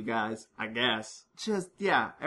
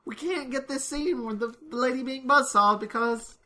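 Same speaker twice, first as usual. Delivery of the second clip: hammed-up furious director voice, pitched high; 10 semitones higher than usual. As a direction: ham up the director's rage, high. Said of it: "And I'm like".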